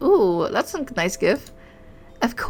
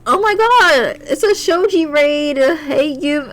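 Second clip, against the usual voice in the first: loud, high squeal of surprise; excited shouting; 6 semitones higher, 6 dB louder, slower than usual. Real, not acted. Streamer greeting a surprise raid, excited shout. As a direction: cry out high and thrilled, stretching the words.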